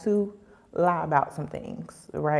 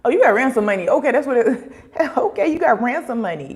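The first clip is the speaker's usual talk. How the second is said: louder, higher, laughing impression of a schemer; mock eager onlooker; 6 semitones higher, 7 dB louder, faster than usual. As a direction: size up a target with loud, amused delight. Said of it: "they looking at you like".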